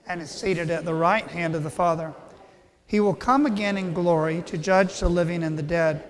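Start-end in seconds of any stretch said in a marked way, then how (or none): none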